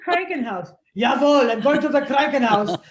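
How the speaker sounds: loud, clipped German officer voice; a hammed-up stern German declaring it; mock stern German. Shown as a demonstration. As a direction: bark it like a drill sergeant. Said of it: "it sounds like Krankenhaus".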